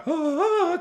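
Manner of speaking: sustained high-pitched held note; high drawn-out "ah". Acted. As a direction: hold a high, steady "ahh". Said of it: "kind of guy, right?".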